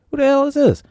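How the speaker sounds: high incredulous squeak; baffled. Retold or imitated. Crew wondering about stranger.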